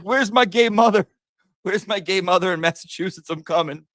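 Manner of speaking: high-pitched comic cat voice; indignant cat. Acted. Streamer voicing the runaway cat seeking its mother, in a high comic voice. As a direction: call out as a cat demanding its mother. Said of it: "He's like".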